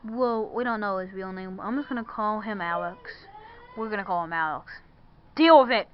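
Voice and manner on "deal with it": loud snap of defiance; defiant shout. Pretend. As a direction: bark it at the doubters. Defiant retort.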